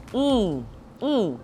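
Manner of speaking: ordinary talk, nothing marked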